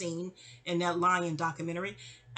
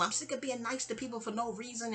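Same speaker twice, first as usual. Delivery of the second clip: softened mock yell; exasperated landlady, held back; Margot; 7 dB quieter than usual. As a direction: imitate a fed-up rant at reduced volume. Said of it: "Margot's yelling".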